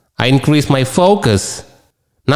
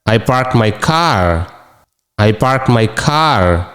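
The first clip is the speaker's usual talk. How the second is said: slow model reading; 3 semitones lower, much slower than usual. Shown as a demonstration. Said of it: "நான் காரை park செஞ்சேன்".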